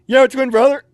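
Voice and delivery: loud, high imitation of a sergeant; barked question, acted out; drill sergeant's shout. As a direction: fire the question at a recruit. Drill sergeant confronts the trainee.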